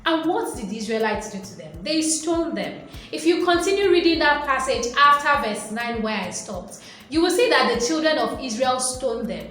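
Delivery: usual speaking voice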